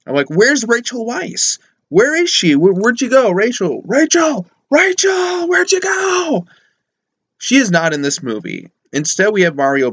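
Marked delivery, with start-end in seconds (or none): 0.3-6.5 high, drawn-out calls building to a shout; mock calling out. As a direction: mock-desperate search, pitch climbing as you call. Mock calling for missing actress.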